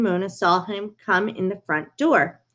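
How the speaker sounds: ordinary delivery, nothing marked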